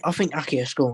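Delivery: normal talking voice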